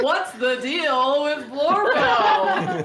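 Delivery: slow, loud, laughing stand-up delivery; Seinfeld voice. Retold or imitated. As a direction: ham up a Seinfeld bit. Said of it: "I read it in Seinfeld voice".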